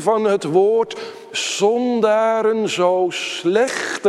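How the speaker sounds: loud, high, driving pulpit delivery; preaching at full force. Acted. Preacher proclaiming the gospel to the congregation, loud and high. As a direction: preach it out, building with each clause.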